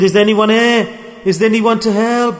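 high, loud desperate call; crying out for help. Acted. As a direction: shout into the void for anyone to hear. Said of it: "He cried out".

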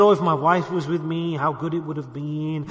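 low, subdued mock lament; lightly acted tearful regret; retold crying. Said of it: "He's saying this, crying, screaming".

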